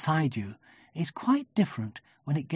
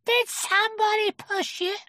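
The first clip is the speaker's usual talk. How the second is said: put-on very high child character voice; excited. A narrator's reading. Roo questioning Eeyore, excited and high. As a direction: squeal the question eagerly. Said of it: "said Roo excitedly".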